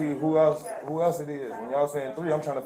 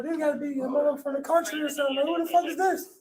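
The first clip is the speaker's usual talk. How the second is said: much higher and fast, stunned disbelief; incredulous disbelief; 13 semitones higher than usual. Retold or imitated. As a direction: squeal it out like you can't believe your eyes. Retold shock at gold teeth.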